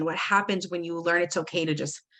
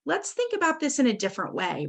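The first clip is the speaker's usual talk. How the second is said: talking to your own brain; 7 semitones higher than usual. Retold or imitated. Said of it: "you're doing that to yourself".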